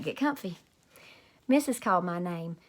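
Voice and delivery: ordinary delivery, nothing marked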